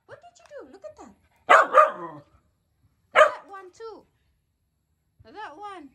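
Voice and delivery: very high scolding of the dog, pointing things out; high pet-scolding voice. Real, not acted. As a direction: tell the dog off in a high sing-song voice.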